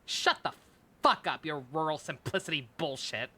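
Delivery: usual speaking voice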